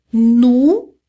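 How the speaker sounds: "Nu" loud and drawn out with a Portuguese u; French nous. Shown as a demonstration. Teacher repeating nous for learners, true U sound. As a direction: hold "Nu" on a strong oo vowel. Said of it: "o som é de U mesmo".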